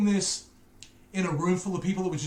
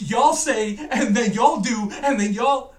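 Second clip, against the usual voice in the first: loud, higher mock American; hammed-up American, pushed loud on "y'all"; mock American accent; 4 semitones higher, 6 dB louder, slower than usual. Brit mimicking [name] roasting UK speech, loud mock American accent. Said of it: "was very American, was to be like".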